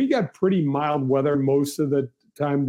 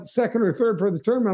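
ordinary delivery

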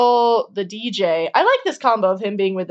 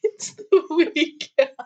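laughing; 5 semitones higher, slower than usual. Real, not acted. Laughing naming the singer.

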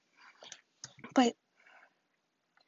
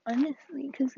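ordinary delivery

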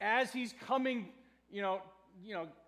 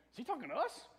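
raised, quick, uncertain aside; puzzled disciples; 4 semitones higher, faster than usual. Acted. Disciples wondering whether the shout is aimed at them, puzzled. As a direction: ask it as a baffled sidelong question. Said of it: "the disciples might be thinking".

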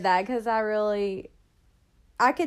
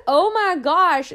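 exasperated; 7 semitones higher, 8 dB louder, slower than usual. Retold or imitated. Reacting to pizza place cancelling.

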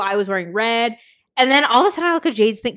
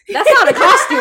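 laughing; 9 semitones higher, 10 dB louder than usual. Real, not acted. Joking about Jade's clothes.

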